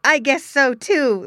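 put-on high, loud boyish agreement; merry, as if laughing. A narrator's reading. Boy agreeing with father, merry. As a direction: agree with a child's amusement. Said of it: "laughed the boy".

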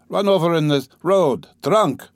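high loud call from the passage; shouting. Acted. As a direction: shout it down the hallway. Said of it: "someone shouted in the passage".

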